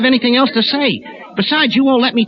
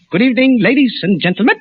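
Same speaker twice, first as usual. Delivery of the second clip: grave, measured newsman with weighty pauses; news commentator impression; 2 semitones higher than usual. Comic at supermarket show, solemn news commentator voice. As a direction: read it like a solemn radio commentator. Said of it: "impersonation will be a well-known news commentator".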